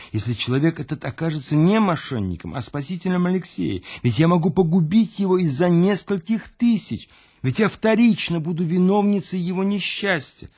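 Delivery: high, fretful worry; anxious pleading. Acted. Louise fretting to the narrator, high and anxious. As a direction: torn by fear of guilt. Louise fears ruining Alexei.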